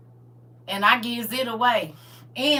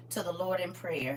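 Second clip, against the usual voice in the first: ordinary delivery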